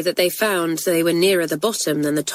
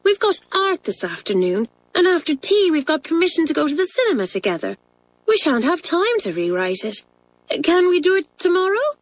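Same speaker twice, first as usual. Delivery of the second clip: higher, polite schoolgirl plea; Isabel politely; 10 semitones higher than usual. Acted. Isabel politely answering the angry French mistress. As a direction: explain respectfully to the teacher. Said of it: "said Isabel politely".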